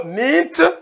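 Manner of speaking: teacher drawing out "nint" for the class; Manjaku word nint. Shown as a demonstration. Teacher slowly demonstrating the Manjaku word to students. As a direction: say "nint" slowly with a short closed i. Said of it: "prononcé nint ou nient".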